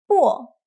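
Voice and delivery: 波 in the falling fourth tone. Shown as a demonstration. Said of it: "practice the four tones".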